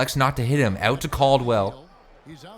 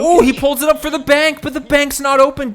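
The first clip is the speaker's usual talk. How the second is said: excited call; 12 semitones higher, 7 dB louder than usual. Real, not acted. Excited call of a missed bank shot.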